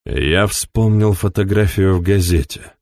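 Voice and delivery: ordinary delivery, nothing marked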